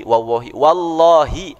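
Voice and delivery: normal talking voice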